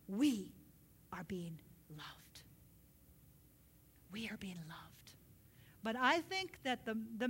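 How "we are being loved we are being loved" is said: slow, low whisper; tender, very soft assurance; hushed reverence. Real, not acted. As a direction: let it sink in, almost whispering. Preacher hushes for key line.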